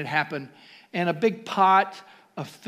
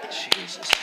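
whispered Jesus; 3 dB quieter than usual. Real, not acted. Whispered after climax.

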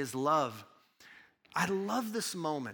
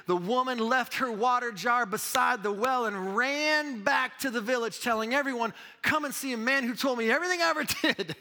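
fired-up scripture reading; 8 semitones higher, 6 dB louder than usual. Acted. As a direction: proclaim the passage to the congregation. Reads scripture aloud loudly.

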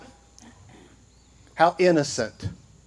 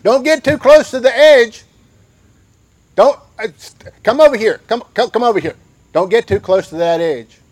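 higher, louder exasperated warning; scolding parent; 4 semitones higher, 5 dB louder than usual. Retold or imitated. Parent calling a child away from the edge, loud and exasperated. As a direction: call the child back from the edge. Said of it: "touch it".

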